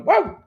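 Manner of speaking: high yelp of a bark; high barking yelp imitation; mock dog bark.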